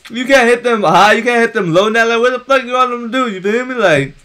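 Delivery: heated argument. Real, not acted. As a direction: argue the call hotly. Arguing about the tackle.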